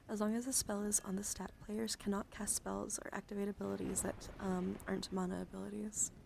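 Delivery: soft whispered ASMR card reading. Acted.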